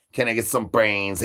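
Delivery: usual speaking voice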